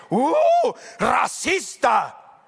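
high, drawn-out accusing cry; hammed-up scandalized yell, very slow; mock outraged shout. Preacher acting out outraged people accusing him, mock shout.

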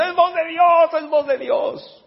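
high, loud cry of acclamation; crowd shouting, not angry. Acted. People taking Herod for God, shouting in acclamation. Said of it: "Cuando estaban gritando, no era porque estaban enojados".

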